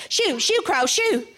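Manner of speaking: high, lively rabbit shooing; brisk little rabbit chasing off crows. Acted. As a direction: rattle off the shoos in a light, high voice. Rabbit pretends to scare crows.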